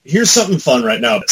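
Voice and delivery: ordinary talk, nothing marked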